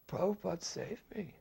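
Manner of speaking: high, simple and grateful; high-pitched heartfelt thanks; Pigpen's grateful voice. Retold or imitated. Pigpen quoted again.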